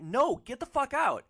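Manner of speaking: screaming at him to leave; furious shout. Retold or imitated. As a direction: throw him out of the car. Angry woman in quarrel.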